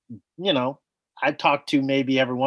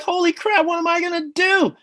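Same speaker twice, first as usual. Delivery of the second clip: panicked; 12 semitones higher than usual. Retold or imitated. Panicked inner thought, pitch up.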